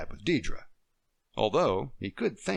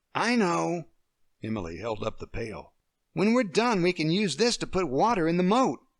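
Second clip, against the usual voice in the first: put-on higher, eager little girl with an idea; 7 semitones higher, 3 dB louder than usual. Emily telling Deirdre her plan for the moat. Child character voice.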